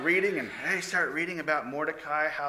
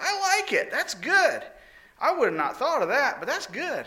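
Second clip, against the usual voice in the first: high, delighted king voice; the king loves the idea; pleased king; 10 semitones higher than usual. Acted. King praises Haman's suggestion, high and pleased.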